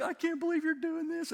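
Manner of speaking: put-on high-pitched awed kid voice; thrilled little boy. Boy riding with dad on the four-wheeler, imitated excitement.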